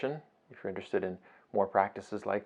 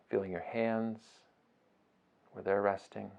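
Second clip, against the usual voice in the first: soft, unhurried meditation voice with long pauses; guided meditation; 7 dB quieter than usual. Acted. Teacher guiding listeners through a beach breathing meditation, soft and unhurried. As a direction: ease them gently through each breath, phrase by phrase.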